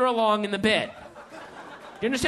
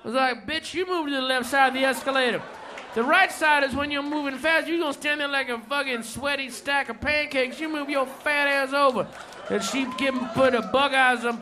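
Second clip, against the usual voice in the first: higher, rowdy storytelling rant; ranting stand-up bit; 3 semitones higher than usual. Retold or imitated. Comedian performs the friend's set, rowdy and higher. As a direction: tear into it like an angry comic.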